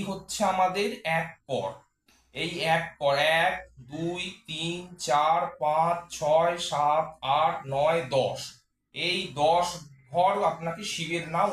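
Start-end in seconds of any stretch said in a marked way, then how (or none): 3.2-8.4 slow counting demo. Shown as a demonstration. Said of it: "শিবের মন্ত্র এইভাবে উচ্চারণ করতে হবে".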